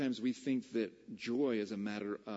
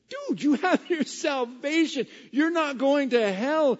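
impassioned preaching; 13 semitones higher, 11 dB louder than usual. Real, not acted. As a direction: shake them out of defeatism. Preaching at full force.

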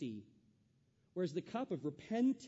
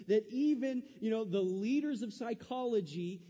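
louder, driving sermon voice; preaching at full force; 6 semitones higher, 6 dB louder than usual. Acted. The pastor urges the congregation to take responsibility, preaching at full force. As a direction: preach it with fire and urgency.

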